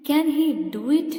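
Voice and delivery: slow question with the weight on "do it"; stress on do it. Shown as a demonstration. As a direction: save the emphasis for "do it", taking it slowly. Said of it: "പിന്നീട് നമ്മൾ do it-ന് stress കൊടുത്തു".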